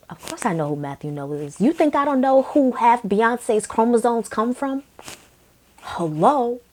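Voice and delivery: of course I know him, so obvious; sarcastic aside. Real, not acted. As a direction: roll your eyes at the question.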